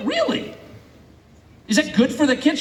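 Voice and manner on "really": Really? jumping far higher in disbelief; sarcastic shock, Really? way up high; incredulous. Acted. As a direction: say Really? as a mocking incredulous squeak. Incredulous rhetorical question.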